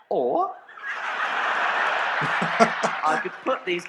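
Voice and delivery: slow, laughing mother's voice. Acted. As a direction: giggle through the mother's plan.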